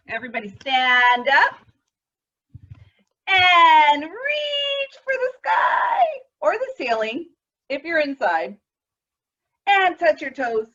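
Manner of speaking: coach call-out. Shown as a demonstration. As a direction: call the class up like a cheerful coach. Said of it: "leading stretches like a coach".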